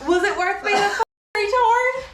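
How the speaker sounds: high, loud yell; angry shout. Real, not acted. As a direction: yell it in his face. Shouting an insult.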